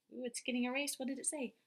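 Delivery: ordinary talk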